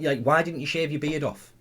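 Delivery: ordinary talk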